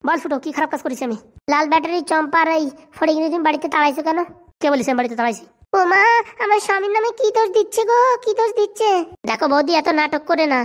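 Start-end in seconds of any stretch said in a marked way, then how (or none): 5.8-8.9 higher, whining wife protesting; whiny wife. Acted. Wife complaining to her husband about Phuto, high whine. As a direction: play the wife, aggrieved and shrill-pitched high.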